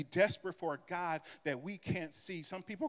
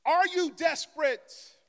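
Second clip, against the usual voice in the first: loud slow challenge; 10 semitones higher, 10 dB louder than usual. Real, not acted. Preacher challenging the congregation, loud and slow. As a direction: call it out loud and slow to the room.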